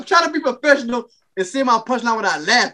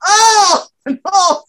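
mock cry of pain; 8 semitones higher, 6 dB louder than usual. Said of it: "And she's like".

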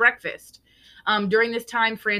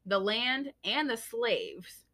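slow and solemn; 3 semitones higher, 4 dB quieter, much slower than usual. Real, not acted.

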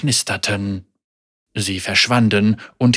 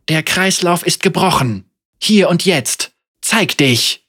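high, loud demand; defiant call; 6 semitones higher, 3 dB louder than usual. Acted. Senna demands the Chain Warden show himself, high and loud. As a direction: call the ghost out, unafraid.

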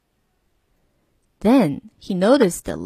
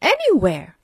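bright, carefree lilt; cheerful; 5 semitones higher than usual. A narrator's reading. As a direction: say it like anything is possible. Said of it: "replied the driver cheerfully".

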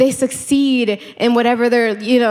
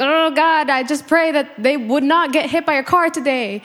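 mock reluctant prayer; 5 semitones higher than usual. Forcing a reluctant prayer for enemy.

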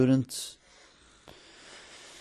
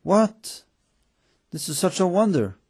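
high, louder cry of wonder; astonished call; 7 semitones higher, 5 dB louder than usual. A narrator's reading. Student at the donkey calling out in amazement. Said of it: "approached the donkey and called out".

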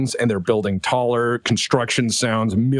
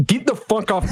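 angry shout; 10 semitones higher than usual. Acted. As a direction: shout them off the shuttle. Officer yells.

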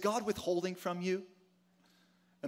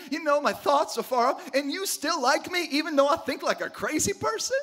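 amazed disbelief; 7 semitones higher than usual. Pretend. Person amazed God still cares.

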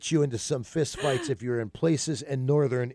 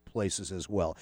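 ordinary talk, nothing marked

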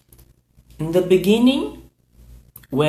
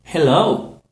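ordinary talk, nothing marked

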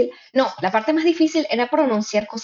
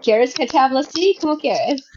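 higher-pitched gringo Spanish; put-on gringo accent, asking eagerly; 5 semitones higher than usual. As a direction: imitate an American speaking Spanish. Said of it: "un acento más gringo cuando hablas".

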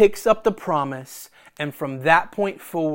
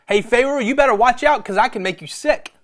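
higher-pitched, cocky mock threat; taunting warning; 6 semitones higher, faster than usual. Preacher voicing God warning Pharaoh, higher mock threat. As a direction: call out a playful warning.

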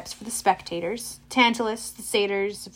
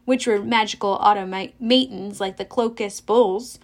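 ordinary delivery, nothing marked

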